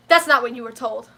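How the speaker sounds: a loud, clipped mimic of a rude clerk; mock curt clerk. Imitating the rude clerk on the phone, loud and curt. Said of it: "The first thing she said to me was".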